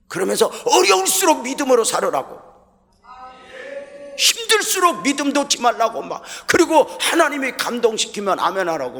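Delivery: loud, high fiery preaching; preaching shout. Retold or imitated. Pastor recalling how he urged faith, loud fiery preaching. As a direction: thunder it from the pulpit. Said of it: "막 내가 막 그렇게 설교했어".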